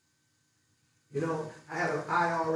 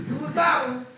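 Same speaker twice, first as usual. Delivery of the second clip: laughing through the words, louder and higher; laughing tease; 9 semitones higher, 10 dB louder than usual. Real, not acted. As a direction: tease him while cracking up. Teasing speaker after audience reaction.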